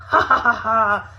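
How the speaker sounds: loud villainous dinosaur cackle; big evil laugh. A narrator's reading. Third dinosaur laughing at the trap, big evil laugh. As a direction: laugh like a gleeful monster. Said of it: "sounded like a big, evil laugh".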